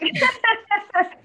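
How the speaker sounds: loud, high-pitched laugh; real high laughing burst. Real, not acted. Laughs at own crying.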